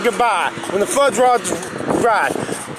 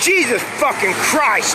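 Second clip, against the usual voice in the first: exasperated oath. Real, not acted. Exasperated oath after long pause.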